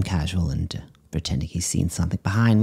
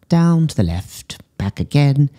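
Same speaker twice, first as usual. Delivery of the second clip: coaxing command; 7 semitones higher, 4 dB louder than usual. Acted. Psychic control of chameleon.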